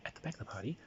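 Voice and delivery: whispered plotting. Acted. Whispering a plan to a fellow player. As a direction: hush it like a conspirator. Conspiring with ally.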